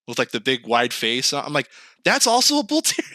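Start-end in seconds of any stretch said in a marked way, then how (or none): 2.0-3.1 high incredulous. Retold or imitated. Shocked at dog photos.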